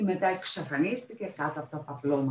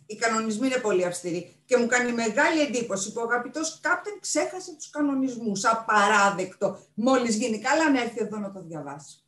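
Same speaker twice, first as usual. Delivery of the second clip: fussy guardian of a treasure, higher pitched; indignant pirate librarian; 6 semitones higher, 4 dB louder than usual. Acted. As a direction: refuse emphatically, hammering the key words.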